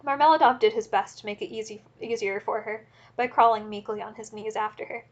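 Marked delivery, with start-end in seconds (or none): none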